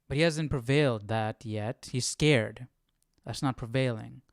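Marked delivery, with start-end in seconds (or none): none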